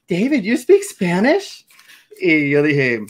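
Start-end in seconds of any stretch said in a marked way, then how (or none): 0.1-1.7 voz aguda y lenta de niña sorprendida; niña boricua sorprendida. Retold or imitated. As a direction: imita a la niña que pregunta asombrada, muy alto y despacio. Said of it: "una niña boricua vira en su asiento y me dice". (imitando a una niña asombrada)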